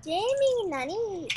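cute anime streamer. Acted. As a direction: call out like an anime girl. Anime girl act continues.

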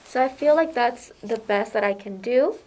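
muffled by respirator; through a face mask. Real, not acted. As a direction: hands cupped over mask. Seen 1: Speaker wears a fitted respirator over her mouth throughout; absent in references.

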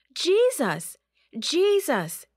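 loud, high desperate call; shouting. Acted. Disciple calling to sleeping Jesus in storm, loud desperate shout. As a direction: yell his name over the storm. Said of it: "he shouted".

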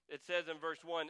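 usual speaking voice

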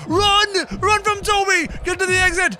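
high, loud panicked yelling at the screen; panicked shout. Real, not acted. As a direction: scream at them to run as the monster closes in. Monster chasing in horror game.

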